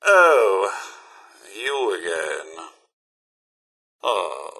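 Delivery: low, drawn-out disappointment; weary displeasure. Acted. Host greeting an unwelcome returning visitor, displeased. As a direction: let the greeting sink with dismay.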